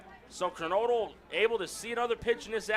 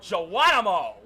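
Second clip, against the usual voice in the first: loud, playful holler; yelled Geronimo; 8 dB louder than usual. Acted. Announcer imagines the pitcher yelling out, playful loud yell. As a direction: belt it out as a mock battle cry. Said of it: "make Prince yell out".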